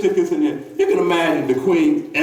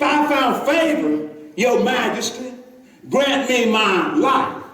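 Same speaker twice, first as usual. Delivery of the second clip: slow solemn plea; 3 semitones higher, slower than usual. Acted. Queen's plea, slow reading.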